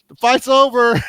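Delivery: very high, loud comic call; mock shouted announcement. Sitcom friend calling to those on the balcony, mock shout. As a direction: holler it high, hamming it up.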